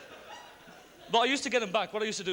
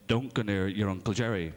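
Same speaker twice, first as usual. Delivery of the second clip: warning parent; 14 semitones lower than usual. Retold or imitated. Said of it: "your parents say".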